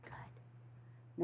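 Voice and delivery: soft whispered praise; quiet whisper of praise. Real, not acted.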